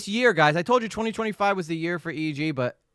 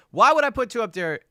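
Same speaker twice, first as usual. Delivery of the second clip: exasperated louder repeat; 8 semitones higher, 8 dB louder than usual. Real, not acted.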